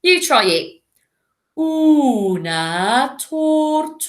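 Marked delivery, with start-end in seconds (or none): 1.6-4.1 very slow, drawn-out tortoise delivery; super slow. Shown as a demonstration. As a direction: crawl through every syllable. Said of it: "go super slowly".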